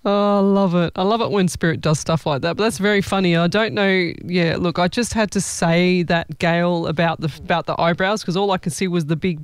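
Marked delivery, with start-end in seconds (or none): none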